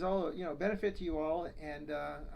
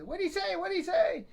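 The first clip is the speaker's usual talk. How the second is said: put-on very high, louder punchline-ready old wife; hard-of-hearing wife; 13 semitones higher, 5 dB louder than usual. Deaf wife asking her husband again, high and loud. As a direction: squeak it out like a deaf granny. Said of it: "the wife says".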